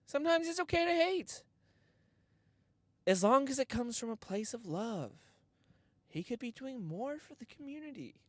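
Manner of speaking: high, soft preachy guru; mock-sage advice; high mock wise voice.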